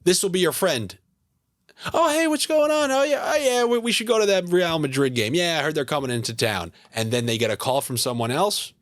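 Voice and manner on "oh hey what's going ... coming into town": high-pitched fake buddy greeting; friend's changed phone voice. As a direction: do a pal putting on a high phone voice. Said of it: "those friends who change voices".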